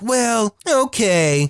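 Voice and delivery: high, put-on kid voice giving in; reluctant agreement. Fighting kids answering the squirrel, reluctantly agreeing.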